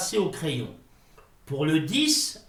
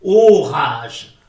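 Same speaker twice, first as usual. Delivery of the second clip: loud, tragic cry of fury; Ô rage! roared in despair; outburst of rage; 12 dB louder than usual. Acted. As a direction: hurl it out like a wounded orator. Explodes at his failure.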